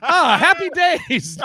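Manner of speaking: high, loud, slow dreamy sigh; mock sitcom bliss. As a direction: swoon over happy days. Mocking old sitcom characters.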